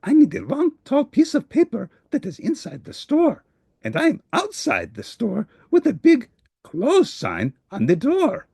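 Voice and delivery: a helper stuck outside; worried Grover. Acted. Grover despairs at the shop.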